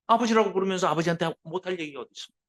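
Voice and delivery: ordinary talk, nothing marked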